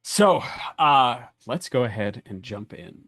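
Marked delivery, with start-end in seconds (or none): none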